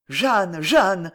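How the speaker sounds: mild anguished cry of her name; panting cry. A narrator's reading. Maréchal interrupting Jeanne's confession, mild panting cry. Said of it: "cria le maréchal Pantelan".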